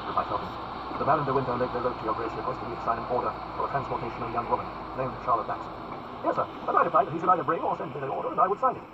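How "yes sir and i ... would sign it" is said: high-pitched lordly Duke; higher, self-assured aristocrat; haughty Duke, brisk. Acted. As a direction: answer as an untroubled nobleman in a raised pitch. Voices the Duke.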